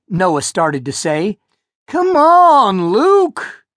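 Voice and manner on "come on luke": high, loud whine; drawn-out nagging call; whiny plea. A narrator's reading. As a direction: whine at him from down the trail. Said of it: "Bobby whined".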